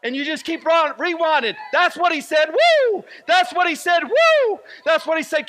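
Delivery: high, loud preaching broken by shrill woos; excited cheering. Acted. Preacher urging listeners to keep rewinding God's words, excited high shouting. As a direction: shout it, punctuated with soaring whoops.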